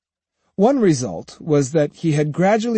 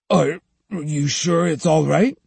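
stammering; 3 semitones higher than usual. A narrator's reading. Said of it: "Sahe stammered".

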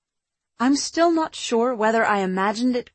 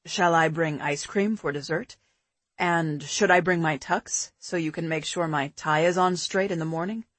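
put-on lower, relaxed boyfriend voice; man's voice; 5 semitones lower, 4 dB quieter than usual. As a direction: drop lower for a calm man. Pete's dialogue, lower voice.

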